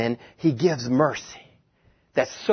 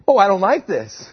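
ordinary talk, nothing marked

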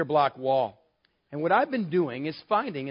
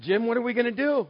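panicked plea; 6 semitones higher than usual. Retold or imitated.

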